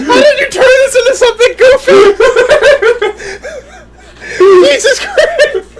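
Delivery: high, squealing laughter through the words; helpless laughing disbelief; cracking up. Real, not acted. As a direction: barely get it out for laughing. Cracks up at co-host's tangent.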